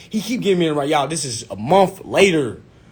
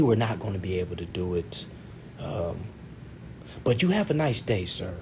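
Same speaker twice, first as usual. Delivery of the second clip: soft, lower, polite customer-service voice; polite advisor; 5 semitones lower, 7 dB quieter than usual. Retold or imitated. Financial advisor calmly declining an application, politely. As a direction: let them down gently, with hesitant pauses. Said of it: "she said it so politely".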